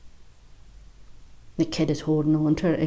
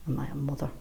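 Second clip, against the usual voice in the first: much softer, inward question; hushed reflection; 4 semitones lower, 12 dB quieter, faster than usual. Acted. Woman asking herself quietly, hushed. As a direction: ask it almost to herself.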